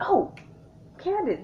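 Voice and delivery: sudden realization. Retold or imitated. Realizing she left her friend behind, surprised and high. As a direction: blurt it as it dawns on you.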